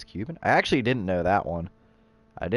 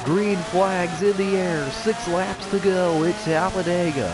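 higher, slow announcer call; race commentator; 9 semitones higher than usual. Acted. Race play-by-play.

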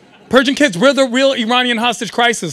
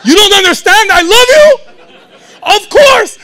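louder, higher mock furious dad yelling love; imitating dad; 4 semitones higher, 8 dB louder than usual. Scene: dad answers his son's question, yelling in mock rage. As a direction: yell "I love you" like a scolding. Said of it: "And he was like".

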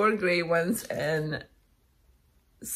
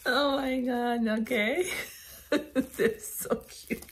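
raised, teary voice breaking into giggles; helpless laughter; 4 semitones higher than usual. Real, not acted. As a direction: try to talk through real laughter. Fit of laughter over gnomes.

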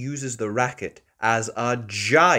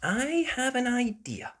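Mummy Pig idea; 12 semitones higher than usual. Acted. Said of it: "says Mummy Pig".

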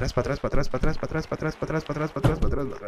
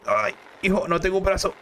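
ordinary talk, nothing marked

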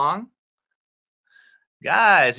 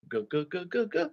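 soft, quick glugs; playful glug-glug sound; gulping noise; 3 semitones higher, 11 dB quieter, faster than usual. Pretend. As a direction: imitate drinking juice down. Mimes gulping down juice.

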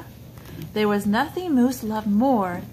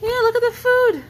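excited; 11 semitones higher, 6 dB louder, faster than usual. Real, not acted. Parent shows toddler the picture book.

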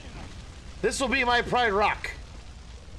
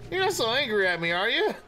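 ordinary delivery

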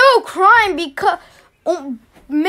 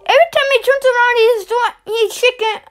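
shocked outburst; 7 semitones higher than usual. Real, not acted. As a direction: blurt it out in disbelief. Shocked reaction at video.

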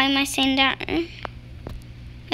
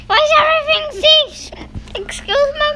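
surprised squeal; 11 semitones higher than usual. Real, not acted.

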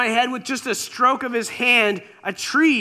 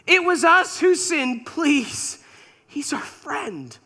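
Eve crying out; 6 semitones higher than usual. Retold or imitated. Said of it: "I imagine Eve crying out".

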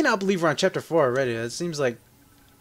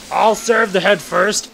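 mock villain; 5 semitones higher, 5 dB louder, slower than usual.

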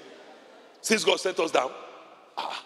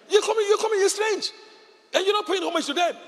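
spirit's warning voice; 3 dB louder than usual. Retold or imitated. Voicing the disturbed demons.

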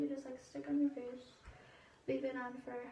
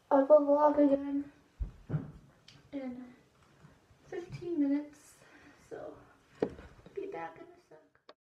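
ordinary talk

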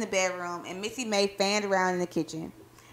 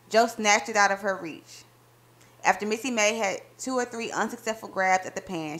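plain reading voice

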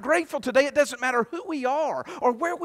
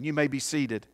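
ordinary talk

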